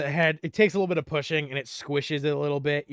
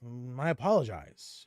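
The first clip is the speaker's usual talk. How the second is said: ordinary talk, nothing marked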